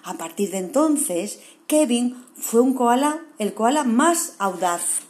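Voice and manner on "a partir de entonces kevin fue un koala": ordinary delivery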